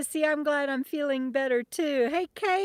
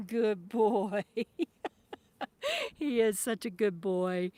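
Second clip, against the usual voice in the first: praising the dog; 4 semitones lower, slower than usual. Real, not acted.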